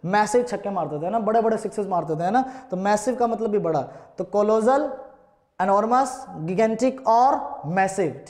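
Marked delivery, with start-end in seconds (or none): none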